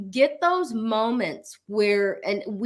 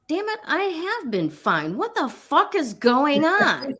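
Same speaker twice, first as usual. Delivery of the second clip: exasperated; 6 semitones higher than usual. Retold or imitated. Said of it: "I'm like".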